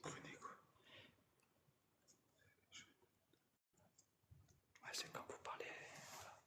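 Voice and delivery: soft whisper in a quiet place; hushed whisper, barely audible. Real, not acted. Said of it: "on va essayer de chuchoter".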